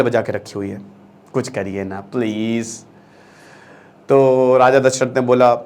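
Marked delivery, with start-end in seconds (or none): 0.0-2.8 soft mock plea. Sages begging Dasharath.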